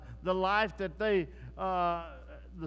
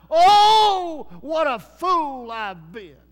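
high, loud anguished wail on Oh, then slower; crying out in regret; 7 semitones higher, 14 dB louder, much slower than usual. Retold or imitated. Thomas Paine at death's door, crying out in loud anguish. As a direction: wail it out like a man facing his doom. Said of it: "cried out".

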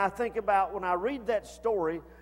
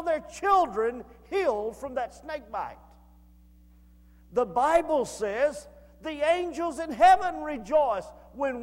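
raised, ringing preacher's voice, shouting the joy; preaching at full force; 6 semitones higher, 4 dB louder than usual. Acted. Preacher urging his congregation toward Jesus, full-force preaching. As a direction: lift the congregation with each rising line. Preacher builds to the gospel climax.

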